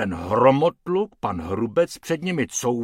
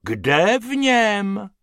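baffled; 9 semitones higher, 6 dB louder, much slower than usual. Acted. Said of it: "nechápal harmonikář".

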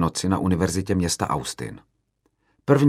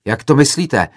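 loud, higher indignant question; raised, agitated challenge; agitated yell; 6 semitones higher, 7 dB louder than usual. A narrator's reading. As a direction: yell back, worked up. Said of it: "zařval rozčileně jiný student".

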